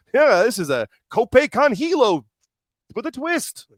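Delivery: high, slow mock move-calling; Excalibur. Host mocking the announcer's calls, sing-out commentary. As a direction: imitate a wrestling commentator showing off move names. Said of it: "Excalibur just gonna call the moves".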